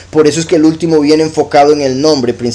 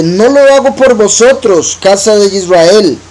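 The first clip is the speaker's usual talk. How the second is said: higher, slow, proclaiming voice; emphatic scripture reading; 5 semitones higher, slower than usual. Acted. Preacher proclaims the verse to the congregation, slow and emphatic. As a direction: declare God's words, slow and lifted.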